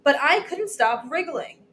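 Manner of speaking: plain reading voice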